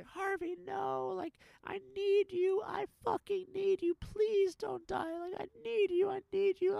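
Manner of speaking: very high, loud, drawn-out wail; screaming in grief. Retold or imitated. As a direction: imitate a woman screaming for her dying dog. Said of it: "her screams were".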